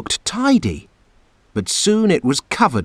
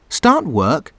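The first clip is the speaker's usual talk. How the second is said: ordinary delivery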